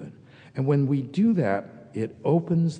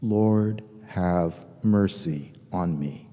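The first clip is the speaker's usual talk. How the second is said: low, slow, devout prayer; slow prayerful murmur; 6 semitones lower, slower than usual. Shown as a demonstration. Pastor showing congregation the prayer, low and slow. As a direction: breathe the prayer out slowly and lowly. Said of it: "And it goes like this".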